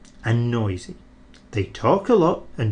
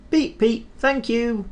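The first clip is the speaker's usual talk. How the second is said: high whistle-like beep then thanks; whistled beep; 10 semitones higher than usual. Acted. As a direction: sing it like a train whistle. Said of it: "he whistled".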